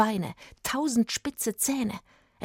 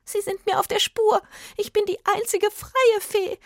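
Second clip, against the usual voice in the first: very high, loud fairy voice; breathless, squeaky fairy alarm; gasping little fairy; 13 semitones higher, 5 dB louder than usual. A narrator's reading. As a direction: cry it out like a frantic tiny fairy. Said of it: "antwortete die Fee nach Luft schnappend".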